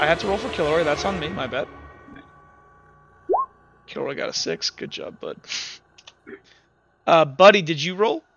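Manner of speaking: ordinary delivery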